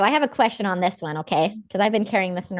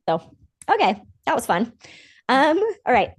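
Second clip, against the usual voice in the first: normal talking voice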